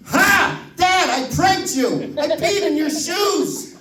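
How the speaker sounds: put-on high, proud toddler crowing; gleeful little kid. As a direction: kid bragging about his prank.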